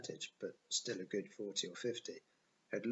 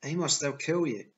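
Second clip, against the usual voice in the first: high, loud alarmed plea; urgent arguing; 6 semitones higher, 9 dB louder than usual. A narrator's reading. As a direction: plead loudly with a friend in danger. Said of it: "I argued".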